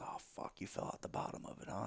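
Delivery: breathy hushed aside, voicing a friend; hushed whisper. Experienced buddy quietly tells me I fell out, whispered. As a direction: whisper it like a knowing secret. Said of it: "He goes".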